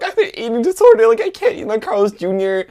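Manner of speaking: very high, loud mock squeal; exaggerated frantic confession; hammed-up panic. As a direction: blurt it out, squealing. Replays panicked confession to mom.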